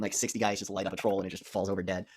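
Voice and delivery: usual speaking voice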